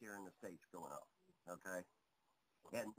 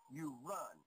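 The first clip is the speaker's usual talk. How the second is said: ordinary delivery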